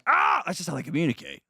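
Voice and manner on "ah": sudden mock baby squeal, much higher; imitated baby cry. Host explaining how babies communicate, mock baby cry. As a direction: yelp like a fussing infant. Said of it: "they do that by going".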